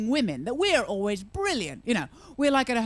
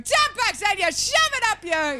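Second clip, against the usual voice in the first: mad woman ad voice; 10 semitones higher than usual. Pretend. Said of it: "Some mad cow with B M T going".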